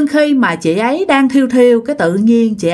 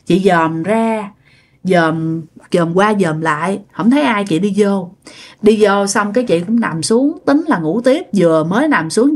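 ordinary delivery

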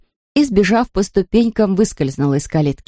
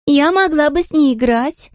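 put-on high child voice; 9 semitones higher than usual. Princess voice continues.

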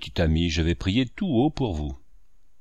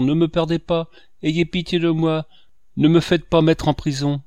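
higher, louder begging for mercy; desperate pleading; 6 semitones higher, 5 dB louder than usual. A narrator's reading. Kneeling peddler boy begs the priest for mercy, desperate pleading. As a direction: plead on your knees, fearing prison.

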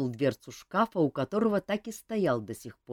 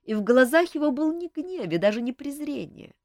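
ordinary delivery

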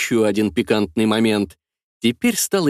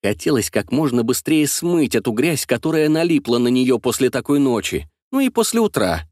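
ordinary delivery, nothing marked

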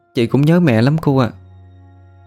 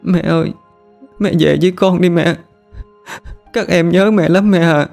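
put-on much higher crying girl's voice; tearful plea; 10 semitones higher than usual. Daughter weeping before her mother's portrait, high and tearful. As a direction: call out to mom through tears. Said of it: "đứng nhìn di ảnh của mẹ nó mà khóc".

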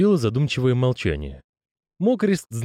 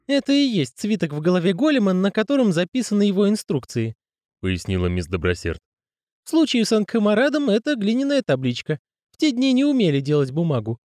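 high woman's voice; female character voice; 12 semitones higher than usual. Acted. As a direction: voice her high and patient. Miss Dobroserd voice.

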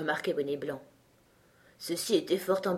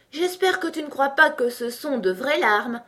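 higher, mildly scornful question; contemptuous question; 7 semitones higher, 4 dB louder than usual. A narrator's reading. Tweedledee mocking Alice's tears, contemptuous. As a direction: ask with a touch of contempt. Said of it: "avec le plus grand mépris".

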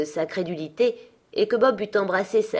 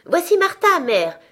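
put-on much higher, louder child voices; excited children; 10 semitones higher, 8 dB louder than usual. A narrator's reading. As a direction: chirp it like eager little kids. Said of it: "répétèrent les deux petits Cratchit". (voix d'enfants excités, très aiguë et forte)

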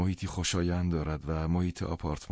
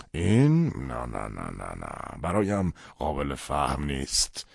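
incredulous father; 3 dB louder, slower than usual. Acted. Father rejects son's conversion, shaken.

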